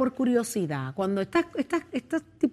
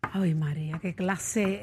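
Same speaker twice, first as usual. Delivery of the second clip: whispering; 6 semitones lower, 7 dB quieter, slower than usual. Real, not acted. Whispered aside on air.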